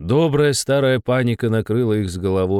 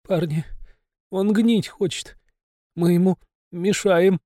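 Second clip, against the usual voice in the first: frightened moan; 8 semitones higher than usual. Acted. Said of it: "тихо простонал".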